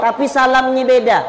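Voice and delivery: normal talking voice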